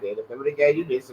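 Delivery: ordinary talk, nothing marked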